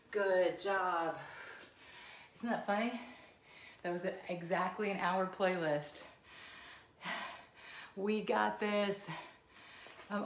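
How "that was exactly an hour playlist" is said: normal talking voice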